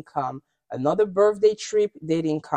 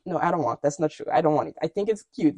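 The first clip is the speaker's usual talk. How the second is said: ordinary delivery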